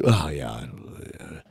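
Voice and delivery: low, trailing-off mumble; glum, deflated half-answer; downbeat imitation. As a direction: imitate a dejected actor, fading to almost nothing.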